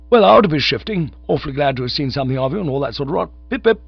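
high, loud, breezy farewell; cheery young chap. A narrator's reading. Cyril taking leave of his friend, high and cheery. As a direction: sign off chirpily.

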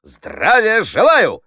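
high, loud, drawn-out military greeting; slow, full-voiced parade-ground cry; shouted salute. A narrator's reading. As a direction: shout it like a recruit to a commander. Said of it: "крикнул он".

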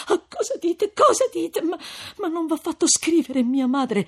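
high, tearful female voice; agitated pleading questions; distressed protest. A narrator's reading. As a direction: protest anxiously, voice soaring. Lucia upset by Renzo's words.

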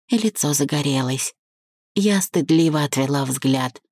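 plain reading voice

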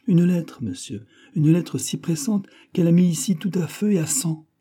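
high-pitched, agitated report; breathless urgency. A narrator's reading. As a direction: report it as a great upheaval. Servant tells of the urgent letter. (récit agité et emphatique)

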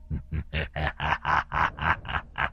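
quiet low demonic chuckle; a ghostly laugh from the dark; low eerie laugh. A narrator's reading. The demon laughs at the mother from the dark.

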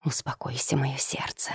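tender hushed whisper. A narrator's reading. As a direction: whisper it softly to calm her. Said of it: "продолжал нашёптывать Влад".

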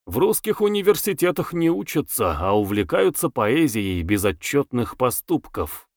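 plain reading voice

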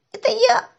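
put-on high, loud child's voice; pained squeal. A narrator's reading. Boy under seat answers man, squealing. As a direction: squeal up from below.

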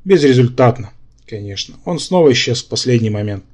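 ordinary delivery, nothing marked

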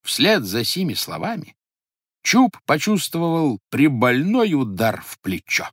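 plain reading voice

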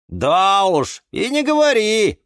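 high, loud chiming in; emphatic agreement. A narrator's reading. Plants agree with Юра about fussy plants, high emphatic voice. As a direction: agree heartily as a gossiping plant. Green friends chime in together.

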